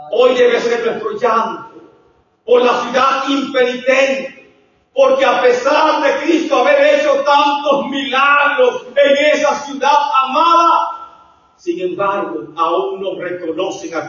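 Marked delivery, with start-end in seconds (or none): 0.1-11.5 loud, drawn-out preaching lament; impassioned pulpit delivery. Real, not acted. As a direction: preach it with rising fervor.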